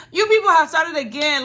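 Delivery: loud annoyed roommate woken at 6 AM; fed-up outburst. Retold or imitated. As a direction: snap at the noisy visitors. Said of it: "I was just like".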